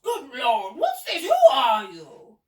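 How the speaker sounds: high exclamations building to a shout; astonished gasping. A narrator's reading. Duke confronted by Billy and pelican, astonished. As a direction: splutter in shock, ending loud and drawn out. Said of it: "he gasped".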